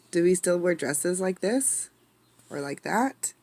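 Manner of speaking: ordinary delivery, nothing marked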